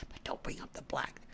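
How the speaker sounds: conspiratorial hush, mocking; mock whisper. Mocking people who avoid race, whispered taboo.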